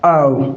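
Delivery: "आओ" as "ov", ending in a V sound; correct F as V. Shown as a demonstration. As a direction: say "आओ" for "of", with V instead of F. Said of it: "क्या बोला जाएगा".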